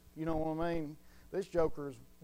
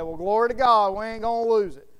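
loud, raised-pitch shout of praise; exultant praise; 4 semitones higher, 10 dB louder than usual. Acted. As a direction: cry it out like a rejoicing preacher. Acted rejoicing over eternal security.

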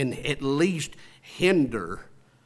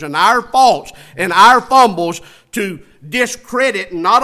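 raised, forceful pulpit delivery; preaching; 7 semitones higher, 8 dB louder than usual. Real, not acted. Preacher warning the congregation about critics, forceful preaching. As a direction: preach it loud and high, leaning on key words.

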